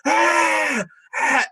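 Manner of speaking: high repeated shrieks; devil's desperate screams; devil panicked screams. Acted. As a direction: scream while being dragged back. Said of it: "he's like".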